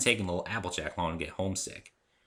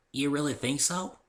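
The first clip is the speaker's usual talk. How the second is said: put-on higher, slower, hopeful young voice; hopeful little filly; 7 semitones higher than usual. Apple Bloom asking the narrator, lightly childlike hope. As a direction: ask like a child daring to hope.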